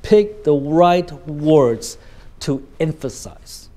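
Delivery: "right words" separated and slow; deliberate emphasis. Shown as a demonstration. Teacher advising students, each word slow and stressed. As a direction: space out "pick the right words" very slowly.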